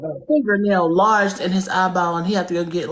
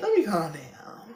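much softer, slower, reining it in; calming down; 8 dB quieter, slower than usual. Real, not acted. Streamer talking themself down from anger, much softer. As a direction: pull the volume way down and slow down. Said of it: "Oh, I'm getting mad".

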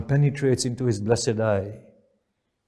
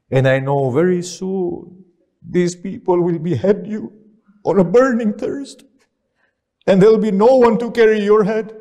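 rising high and loud lament, faster at the end; dying brother's anguish, pitch climbing; 6 semitones higher, 3 dB louder than usual. Acted. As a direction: wail it out in grief. Dying brother foresees beheading.

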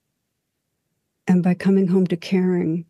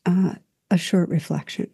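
ordinary talk

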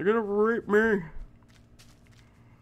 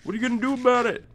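bragging; 3 semitones higher, 4 dB louder than usual. Retold or imitated. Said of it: "just for bragging rights to be like".

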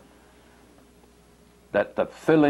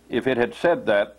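ordinary delivery

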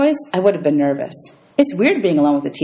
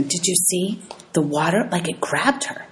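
put-on hushed, softer kid whispers; whispering; 5 dB quieter than usual. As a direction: murmur it like gossiping kids. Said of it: "Some of the kids were whispering".

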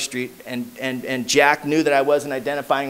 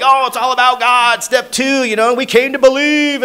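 higher and louder, mocking sing-song preachiness; preachy old-timer; 8 semitones higher, 6 dB louder than usual. Newcomer mocking what he heard at meetings, higher and louder. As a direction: parody the God talk. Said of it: "I heard, like".

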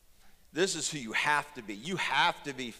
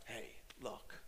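whispered prayer; 12 dB quieter than usual. Real, not acted. Desperate prayer to God.

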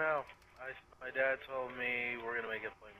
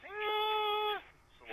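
much higher, slow held whine; imitating ringing. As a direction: hold a high siren-like tone. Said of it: "like a siren noise".

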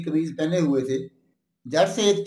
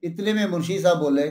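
ordinary delivery

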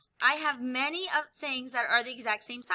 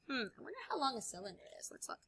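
whisper; 13 dB quieter, much faster than usual. Pretend. Teacher wonders aloud, whispered.

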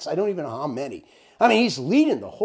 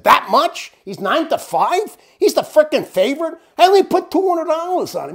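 higher and louder, retelling his outrage at the odds; indignant disbelief; 11 semitones higher, 7 dB louder than usual. Retold or imitated. Retelling his shock to Ed, loud indignant disbelief. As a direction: hit each question like you can't believe it.

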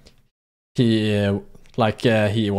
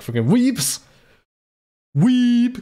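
high-pitched mock jeer, held long; mock taunt; 11 semitones higher, much slower than usual. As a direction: jeer it in a raised, drawn-out voice. Mock outburst at anime fans.

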